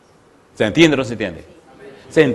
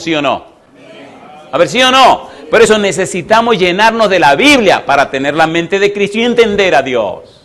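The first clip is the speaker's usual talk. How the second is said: raised, insistent sermon voice; impassioned preaching; 4 semitones higher than usual. Real, not acted. Preacher lamenting churches that don't know God, forceful. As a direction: press every point on the congregation.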